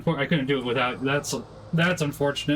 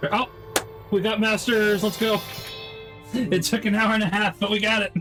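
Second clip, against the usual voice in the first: excited; 7 semitones higher than usual. Real, not acted. Finally beating a hard mission.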